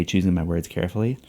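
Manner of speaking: usual speaking voice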